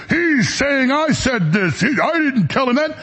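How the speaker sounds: high, drawn-out mock indignation; pissed-off mimicry. Host mimics a pissed-off former guest complaining about scoops, indignant. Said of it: "Bongino got pissed".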